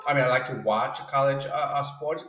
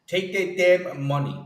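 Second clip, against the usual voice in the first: normal talking voice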